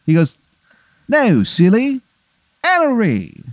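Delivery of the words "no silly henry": very high, hammed-up English accent; dropped-H fake English, pitched way up; Henry the eighth, bad English accent. Silly king corrects his name, in a bad English accent. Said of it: "it's a very bad English accent".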